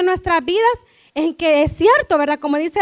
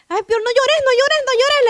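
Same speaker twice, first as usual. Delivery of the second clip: put-on higher-pitched, cooing parent comforting a little girl; soothing parent; 8 semitones higher than usual. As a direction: coo it as if to a crying toddler. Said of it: "le digo yo". (voz aguda de mamá consolando a su niña)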